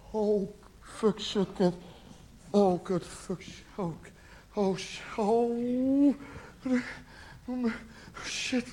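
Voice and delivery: soft, halting curses with stretched oh; dismayed cursing. Acted. Boyfriend left alone after she walks out, soft dismayed swearing. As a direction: curse under your breath as it sinks in.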